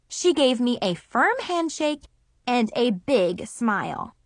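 ordinary delivery, nothing marked

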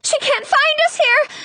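very high, frightened shriek; panicked shriek. A narrator's reading. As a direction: shriek it in alarm. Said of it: "Snow shrieks".

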